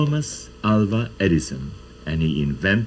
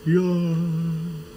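mountain echo; 10 semitones higher than usual. Shown as a demonstration. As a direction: let the name float back like a far-off echo. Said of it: "the voice will come back".

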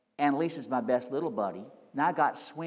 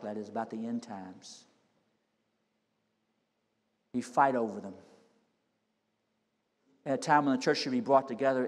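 prayer. Acted. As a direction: pray humbly over the people. Closing prayer.

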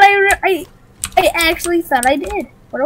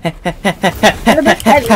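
mock deep voice; 8 semitones lower, much slower than usual. Friend mocking the phrase in a low slow drone. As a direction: drop way lower, slow and droning.

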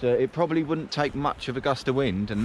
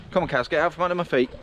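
raised, quick shoo at the cows; 6 semitones higher, 4 dB louder, faster than usual. Real, not acted. Walker shooing cows from under his feet, loud and brisk. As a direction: hurry the cows along, loud and fast.

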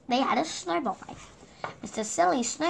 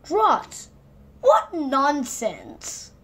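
higher and louder, nonsense very slow; punchline exclaim; 3 semitones higher, 5 dB louder, much slower than usual. A narrator's reading. Narrator ending the story on its pun, loud and drawn out. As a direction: deliver the joke with relish.

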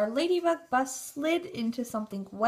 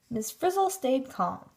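ordinary delivery, nothing marked

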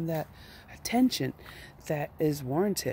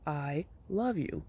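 slow, softer, sincere declaration; drawn out and heartfelt, much slower; really meaning it; 5 dB quieter, much slower than usual. Shown as a demonstration. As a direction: say it slowly and softly, meaning it. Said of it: "Now really say it. Really mean it".

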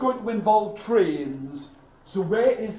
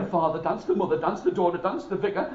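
ordinary delivery

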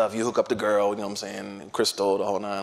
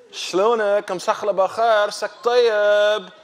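higher, louder Khaliji greeting; Khaliji accent; 8 semitones higher, 5 dB louder, slower than usual. Retold or imitated. Saudi woman greets the convert, imitated Khaliji accent. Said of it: "She was a Khaliji".

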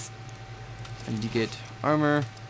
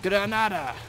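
higher-pitched mock foreign cry of the word; impersonation; 7 semitones higher, slower than usual. Gamer showing off his only impersonation after grenade talk, raised pitch. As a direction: call it out as a hammy little impersonation. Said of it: "that's my only im-, im-, impersonation".

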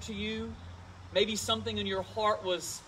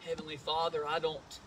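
prayer; 5 semitones lower, 3 dB quieter than usual. Real, not acted. Pastor begins prayer.

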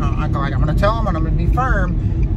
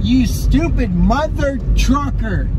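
mock insult; 7 semitones higher, 6 dB louder, much slower than usual. Mock cussing out.

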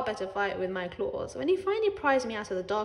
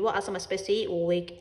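ordinary delivery, nothing marked

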